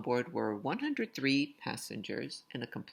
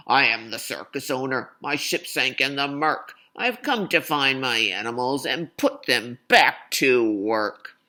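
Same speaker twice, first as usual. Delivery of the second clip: booming, much louder blustering owner; loud and clear; 14 dB louder than usual. Acted. Greedy circus owner announcing himself to the townsfolk, booming loud. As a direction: proclaim it to the whole town. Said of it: "whose voice was loud and clear".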